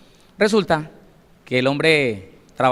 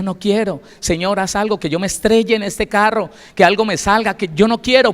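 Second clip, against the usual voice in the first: pleading to God, building higher and louder; a retold cry for help that climbs to a near shout; desperate plea; 6 semitones higher, 5 dB louder than usual. Woman retells her desperate prayer. (súplica desesperada, cada vez más aguda y fuerte)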